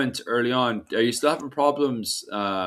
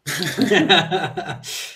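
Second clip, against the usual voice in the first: laughing; 7 semitones higher than usual. Real, not acted. Laughing at own joke.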